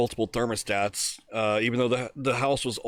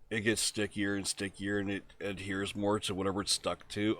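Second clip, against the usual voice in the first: quiet dad explaining; 4 semitones lower, 9 dB quieter than usual. Retold or imitated. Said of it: "He goes".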